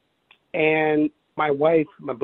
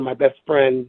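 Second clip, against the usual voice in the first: ordinary talk, nothing marked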